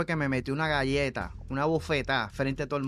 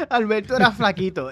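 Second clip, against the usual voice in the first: ordinary delivery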